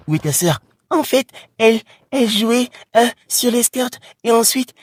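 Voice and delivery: lightly voiced child answering; little girl.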